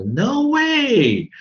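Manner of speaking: very high, loud, drawn-out "no way"; imitated protest. US-born cousin rejecting the dictionary's pronunciation, imitated loud protest. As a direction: mimic a cousin's loud, high-pitched objection. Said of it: "他说".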